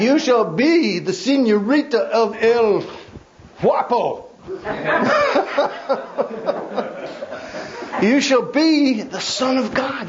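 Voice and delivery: grand, then crack up; laughing mock decree. Preacher joking with the congregation about El Guapo, laughing.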